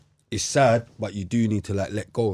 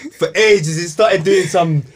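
laughing; 6 semitones higher, 4 dB louder than usual. Real, not acted. Still laughing at friend.

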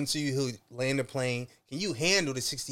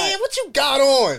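blunt stranger; 8 semitones higher, 9 dB louder than usual. Retold or imitated.

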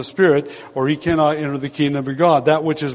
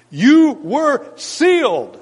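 slow, high "You were sealed", drawn out; emphatic preaching; 10 semitones higher, 3 dB louder, much slower than usual. Acted. Preacher driving home the verse to congregation, slow and high.

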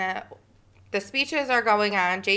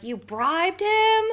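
shocked question; 11 semitones higher, slower than usual. Retold or imitated. Toby's shocked quote.

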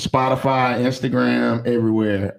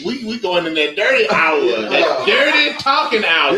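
loud, laughing hype with a high squeal; excited hype shout; 8 semitones higher, 6 dB louder, slower than usual. Real, not acted. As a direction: whoop it up for the late-night segment. Host hypes the dirty talk hour.

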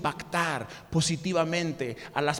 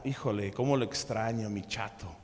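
soft, low, wistful sigh of a widow; longing widow; 10 semitones lower, 8 dB quieter than usual. Acted. Widow softly missing her husband, wistful. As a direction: let her ache for her late husband.

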